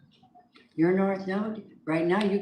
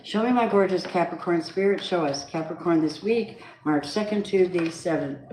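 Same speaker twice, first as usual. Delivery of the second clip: ordinary talk, nothing marked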